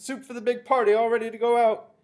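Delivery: high, loud kitchen call; shouted. A narrator's reading. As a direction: holler the order across the kitchen.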